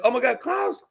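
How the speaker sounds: imitated delighted hello; excited greeting. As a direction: greet a friend you've just spotted. Friend spots Klaus on street.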